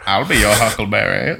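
imitating a movie gunslinger's Southern aristocrat accent; playful take on the cowboy accent; weird Western drawl. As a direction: quote the famous line in an old Western accent. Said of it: "with his, like, weird accent".

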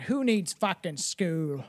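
slow, lightly drawled Southern bum voice; a hint of a Southern drifter scoffing; mock Southern drawl, slowed right down. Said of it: "like some sort of, uh, fucking down south bum".

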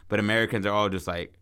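ordinary delivery

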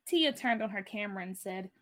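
ordinary delivery

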